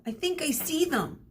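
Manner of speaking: high excited voice; excited call. Acted. Sheeran calling to friends in the storm, high excited voice. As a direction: point and call out. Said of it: "he yelled".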